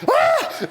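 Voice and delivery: high, loud startled scream; panicked scream. Retold or imitated. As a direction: shriek as the notes hit the floor. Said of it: "I'm like".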